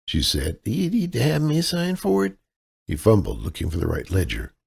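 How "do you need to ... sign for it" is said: higher, helpless Midwestern damsel voice; damsel in distress. Acted. Kaylee sweet-talks the clerk as a damsel in distress. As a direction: play the sweet, lost out-of-towner, pitched up. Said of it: "her damsel in distress persona".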